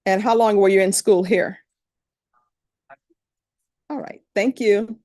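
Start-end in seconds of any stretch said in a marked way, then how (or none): none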